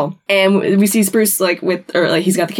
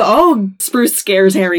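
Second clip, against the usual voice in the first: ordinary talk, nothing marked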